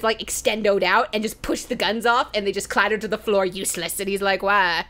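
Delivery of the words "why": ordinary delivery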